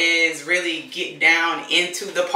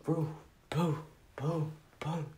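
quiet, spaced-out little booms; hushed "boom" sound effects; soft popping booms; 14 dB quieter, slower than usual. Shown as a demonstration. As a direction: softly mimic curls popping out one by one. Imitates curls popping at takedown.

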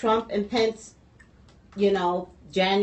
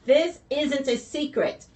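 slow, higher, deliberate delivery; getting it out; 6 semitones higher, slower than usual. Real, not acted. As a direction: push through the stutter to finish "secret". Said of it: "I can't say it".

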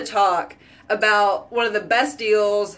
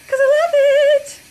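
much higher, louder gushing cry; gushing squeal; 7 dB louder, much faster than usual. Real, not acted. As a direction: burst out with love for it. Gushing about lashes.

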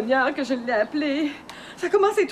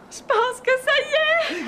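excited call; 8 semitones higher, slower than usual. Acted. Baby is coming, calling Léon.

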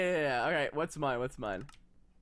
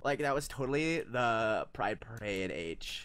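put-on valley girl voice; 10 semitones lower than usual. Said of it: "Talk like a valley girl".

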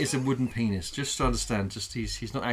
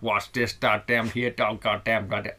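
put-on slow, fumbled rural American accent; attempted American rancher accent; 3 semitones lower than usual. Host as rancher grumbling at debris, fumbled American accent. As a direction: have a clumsy go at an American rancher. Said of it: "I don't know what they're saying".

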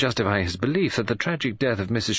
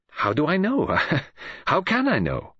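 high bitter repetition with a hollow laugh; father's bitter outburst about his daughter; 9 semitones higher than usual. A narrator's reading. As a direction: throw it out bitterly, laughing without joy. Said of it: "suddenly bitter".

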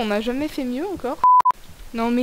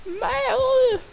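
mock dramatic scold; 10 semitones higher, much slower than usual.

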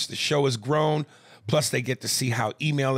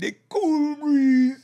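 put-on slow, much higher Jamaican accent on "The cool breeze"; 6 dB louder, much slower than usual. Quoting Aries' Jamaican accent for podcast fans. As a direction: stretch "The cool breeze" out in a high Jamaican lilt. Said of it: "in his Jamaican accent".

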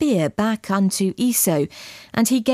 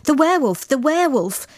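panicked crowd crying out, higher; alarmed cries; 6 semitones higher than usual. Acted. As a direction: call out the alarm in fright. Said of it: "there were cries of".

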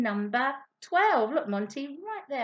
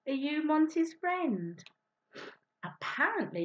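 normal talking voice

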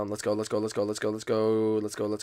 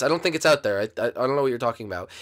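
ordinary delivery

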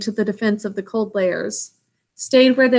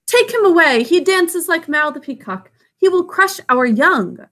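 alarmed cobras; 7 semitones higher, 3 dB louder than usual. Acted. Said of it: "said the cobras inside".

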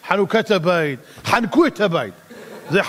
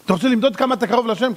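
usual speaking voice

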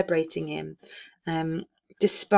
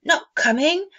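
high, louder disbelieving question; dismayed surprise; 10 semitones higher, 5 dB louder than usual. A narrator's reading. Bob questioning his wife in dismay. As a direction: sound let down and astonished. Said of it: "with a sudden declension in his high spirits".

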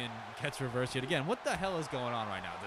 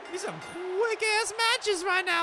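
hyped-up voice; 8 dB louder, much slower than usual. Acted. Hyped commentator aside.

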